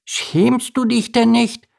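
put-on very high, slow little-girl voice; squeaky-high, drawn-out child's reproach; tiny mouse girl. A narrator's reading. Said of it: "fragte ein winziges Mäusemädchen".

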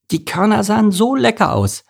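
high, gleeful delight; cheerful. A narrator's reading. Merle telling Mike about the tasty grains, cheerful. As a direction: gush happily in a high voice. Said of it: "sagte Merle fröhlich".

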